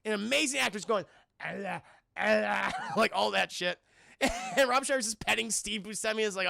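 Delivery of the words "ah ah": dog panting. Retold or imitated. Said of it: "He's acting like a fucking dog".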